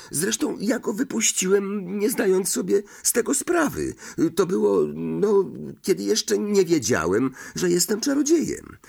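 high-pitched, hurried-sounding excuse; Harry, defensive explaining. Acted. Harry excuses freeing the snake to Ron, defensive and high. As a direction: justify yourself in a high voice.

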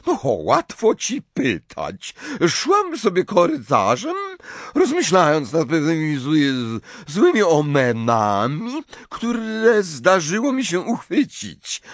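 put-on high, slow voice of an eccentric old prophetess; Professor Trelawney.

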